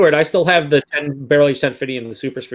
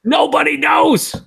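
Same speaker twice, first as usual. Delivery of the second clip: dramatic shout; 11 semitones higher, 3 dB louder, slower than usual. Acted. Mock dramatic answer.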